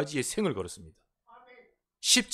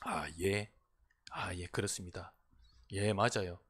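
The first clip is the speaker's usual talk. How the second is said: soft, low, humble yes-saying; meek, soft "yes, that's right"; meek agreement; 8 semitones lower, 9 dB quieter than usual. Shown as a demonstration. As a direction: agree politely and quietly. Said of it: "그때는 어떻게 하는 줄 아십니까?".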